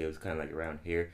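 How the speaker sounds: low, rough sore-throat voice; low raspy voice. Shown as a demonstration. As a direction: drop low and rasp a little. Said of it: "but also I was kinda raspy".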